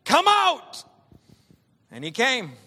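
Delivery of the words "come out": high, loud command; Jesus calling Lazarus out of the tomb, loud and commanding. Retold or imitated. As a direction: call the dead man out of the tomb. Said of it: "Jesus called out in a loud voice".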